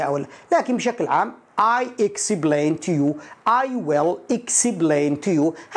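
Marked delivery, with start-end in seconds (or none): 1.6-5.8 very slow, stretched English demonstration; model sentence. Shown as a demonstration. Teacher models what learners want to hear, very slow English. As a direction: draw each word right out. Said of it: "هذا الشرح الذي تريد أن تسمعه".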